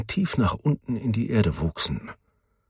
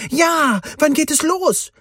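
much higher, louder, bursting with joy; jubilant cheer; 5 dB louder than usual. A narrator's reading. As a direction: cheer like an overjoyed boy. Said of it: "Ethan jubelte". (jubelnd, viel höher und lauter)

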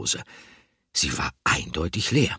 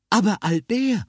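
shopkeeper woman's voice; 5 dB louder than usual. A narrator's reading. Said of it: "antwortete die Stimme von Frau Fröhlich".

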